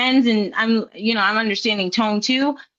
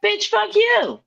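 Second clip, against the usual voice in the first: angry retort; 7 semitones higher, slower than usual. Retold or imitated. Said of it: "they could still be like".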